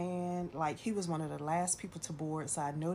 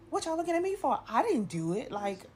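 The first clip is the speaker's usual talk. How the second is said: high, loud "not my fault" protest; squawking at the onlookers; defensive outburst; 9 semitones higher, 5 dB louder than usual. Retold or imitated. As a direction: fend off the staring crowd. Crowd stares after failed proposal.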